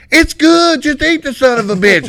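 high, loud pushy friend; loud urging buddy. Retold or imitated. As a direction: shout it up high, pushing him to eat. Kenny urging buddy to eat.

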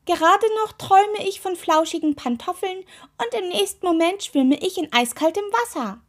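high, loud scolding from a cranky talking toe; high-pitched indignant rant. A narrator's reading. Grumpy toe woken in cold water, scolding. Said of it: "schimpfte er".

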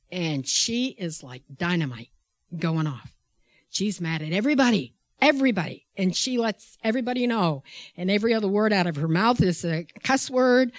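normal talking voice

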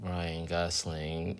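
slow, robotic monotone name. Real, not acted. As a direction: say it like a robot, slowly. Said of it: "I sound like a robot".

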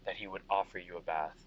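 low, weary general; tired soldier. Acted. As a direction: sigh through a long shift. GM plays tired Vega.

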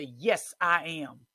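firm loud declaration; loud affirmation. Shown as a demonstration. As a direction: fling it back at the devil. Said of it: "you say".

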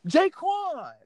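high, drawn-out name call; imitated girl calling out. Girl chasing him down calls his name, high singsong call. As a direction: put on a high girl's voice calling after someone.

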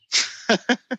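short wry chuckle; real breathy chuckle; laughing. Real, not acted. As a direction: chuckle to yourself after making the point. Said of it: "I'm laughing because there's one word".

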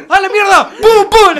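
high, very loud mock outburst; retold startled shout. Friend acting out the scary neighbour story, loud high shout. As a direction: yell it out, hammed-up, through the laughs. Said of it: "Y decía".

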